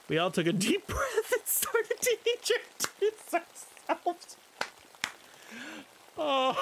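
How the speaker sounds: laughing through the words; cracking up mid-sentence. Real, not acted. Reading cursed fanfic, breaks into laughter.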